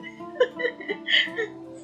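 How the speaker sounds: high airy giggle; laughing. Real, not acted. Streamer amused by own Manchester United remark, laughing. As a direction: giggle at your own joke.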